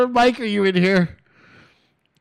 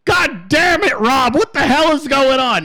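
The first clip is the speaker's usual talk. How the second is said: acted angry boss yell, higher; screaming; 5 semitones higher than usual. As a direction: holler it like an irate billionaire on the phone. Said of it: "he's fucking screaming".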